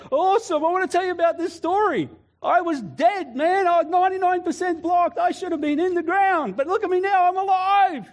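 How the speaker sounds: high, loud, exuberant rush of joy; hammed-up elated survivor bursting to tell their story; excited patient. Acted excited cardiac ward patient.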